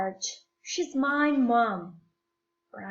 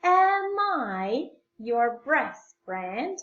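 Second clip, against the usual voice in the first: slow teaching model; slower than usual. Shown as a demonstration. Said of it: "you change the place of a verb and the subject".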